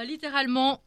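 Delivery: ordinary talk, nothing marked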